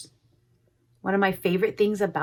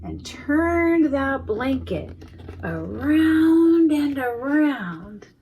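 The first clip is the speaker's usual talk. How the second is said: slow and higher, turning round; drawn out; 6 semitones higher, 5 dB louder, much slower than usual. A narrator's reading. Reader to children, slow higher drawn-out turning. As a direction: stretch it out like the turning.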